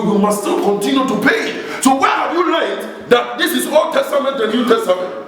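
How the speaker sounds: high-pitched, insistent pulpit delivery; full-force preaching. Real, not acted. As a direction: preach it hard, drawing out the key words.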